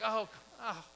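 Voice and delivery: whiny. Retold or imitated. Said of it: "We kinda whine about it".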